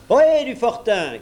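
loud, high-pitched hail; clear, vigorous call. A narrator's reading. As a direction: hail the fort from afar. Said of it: "criait-on".

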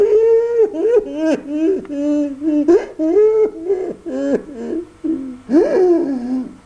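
high wailing cry without words; loud wailing sob. A narrator's reading. Pinocchio at the grave, loud wailing sob. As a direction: howl out the grief. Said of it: "Tout en se lamentant ainsi".

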